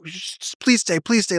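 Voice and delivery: put-on high, begging child's voice; pleading kid. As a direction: beg him not to leave, voice way up. Kid begging manager to wait.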